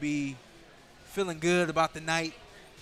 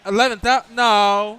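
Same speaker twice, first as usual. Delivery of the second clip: stunned, loud disbelief; can't believe the payout; surprised; 5 semitones higher, 15 dB louder than usual. Real, not acted.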